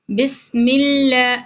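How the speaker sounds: بسم اللہ with a thin, light lam, slow. Shown as a demonstration. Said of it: "لام یہاں پر باریک پڑھا جائے گا". (بسم اللہ میں لام باریک)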